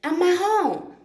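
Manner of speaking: a retold yell at intruders, high and sharp; mock yelling get out of my home; mock shout.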